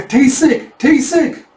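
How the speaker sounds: high, loud panicked calling; alarmed cry. Acted. Villager calling out amid the attack, high alarmed cry.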